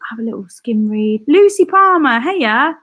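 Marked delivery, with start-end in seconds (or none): 1.3-2.8 loud, high singsong greeting; excited shout-out. Real, not acted. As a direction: call out to a friend you just spotted. Greets a viewer spotted in chat.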